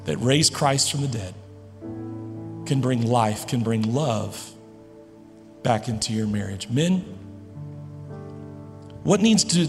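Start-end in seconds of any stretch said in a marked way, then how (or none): none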